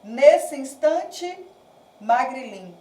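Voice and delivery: "Nesse instante, magrilim" slowly drawn out syllable by syllable; measured scansion, "Nesse" run into "instante"; poetic syllable division. Shown as a demonstration. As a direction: stretch "Nesse instante", pause, then "magrilim". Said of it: "Quando eu faço a divisão poética".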